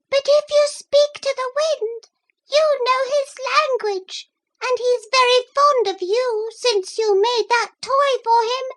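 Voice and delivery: very high, thin, sharp little voices crying for help; thin sharp mussel voices. A narrator's reading. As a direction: squeak out in panic like tiny shellfish. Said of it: "voices were thin and sharp".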